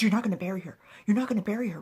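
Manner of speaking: loud, urgent burst of protest; acted distressed protest. Grieving attendant protests the burial plan, acted distressed outburst.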